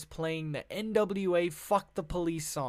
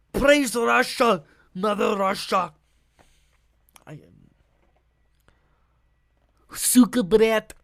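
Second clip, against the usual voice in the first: mock Russian cheer; 3 semitones higher, 8 dB louder than usual. Vlogger hams up a loud mock Russian cheer for Putin. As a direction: belt out a fake Russian salute.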